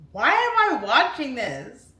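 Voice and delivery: loud, high, slow cry of disbelief; horrified disbelief. Retold or imitated. Remembering her dad's movie, loud horrified wail. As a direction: recoil and wail it out as if you can't look away. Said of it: "I'm like".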